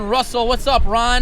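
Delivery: ordinary delivery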